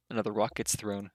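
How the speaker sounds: usual speaking voice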